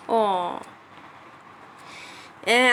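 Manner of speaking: ordinary talk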